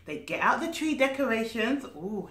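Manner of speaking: plain reading voice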